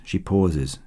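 usual speaking voice

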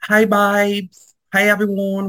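high-pitched British greeting; mock British accent. Greeting everyone at work, mock British accent. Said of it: "addicted and obsessed talking like I'm British".

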